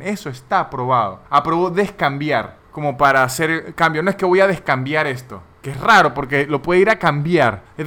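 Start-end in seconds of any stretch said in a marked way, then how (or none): none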